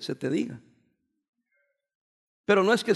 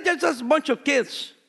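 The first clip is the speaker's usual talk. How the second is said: mock American excuse; 5 semitones higher, slower than usual. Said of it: "yo me quedé sorprendido cuando dijeron".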